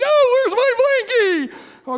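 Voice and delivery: put-on high, loud, drawn-out child's wail. Child missing a favorite blanket, loud acted wailing. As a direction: wail like an inconsolable kid. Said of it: "she is wailing".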